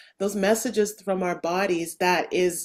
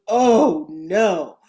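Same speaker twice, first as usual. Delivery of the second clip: alarmed; 7 dB louder than usual. Retold or imitated. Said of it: "an absolute".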